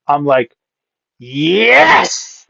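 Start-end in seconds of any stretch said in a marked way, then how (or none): 1.2-2.5 drawn-out yes, sweeping up high; a triumphant, celebrating "yes"; elated yes. Retold or imitated. Man hearing the model likes him, acting out elation. Retelling his elation at good news.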